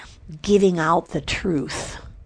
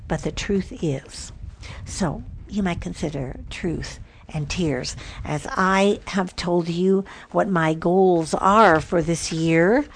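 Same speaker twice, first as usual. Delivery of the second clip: usual speaking voice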